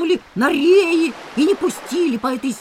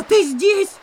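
normal talking voice

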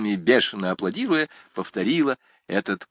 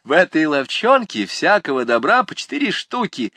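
mocking shout; 7 semitones higher, 4 dB louder than usual. A narrator's reading. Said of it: "крикнул третий".

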